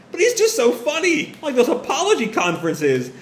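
high, tickled mock hilarity; laughing hard. Mother rolling on the couch laughing, high amused voice. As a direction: start high, as if barely containing laughter. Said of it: "rolling on the couch laughing".

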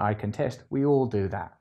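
ordinary talk

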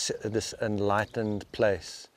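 ordinary talk, nothing marked

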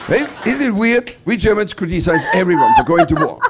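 ordinary talk

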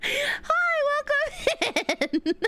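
high, bubbly streamer greeting; cute anime streamer. Acted. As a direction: sing out a cute high hello.

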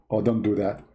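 usual speaking voice

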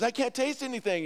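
loud, high-pitched protest; hammed-up patient's grievance; acted complaint. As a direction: complain loudly to the doctor. Joe restates complaint to doctor.

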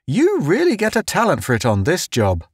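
quick, high, delighted patter; gleeful. A narrator's reading. Town delighted by Pace's time sense, quick high glee. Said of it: "he said gleefully".

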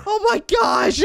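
high shout of alarm; startled shriek. Acted. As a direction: shriek in shock. Startled by approaching character.

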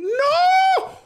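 high loud shouted no; shouting. Real, not acted. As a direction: scream it out. Character falling in game.

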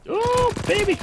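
high, thrilled yelp; excited shout. Real, not acted. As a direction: cheer a kill. Big kill during the game.

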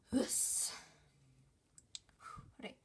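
breathless and panting; catching breath between words. Real, not acted. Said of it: "I'm out of breath".

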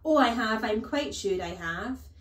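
smug and loud, smiling; grinning. Acted. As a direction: relish the cruelty with a grin.

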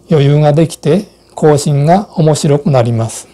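ordinary talk, nothing marked

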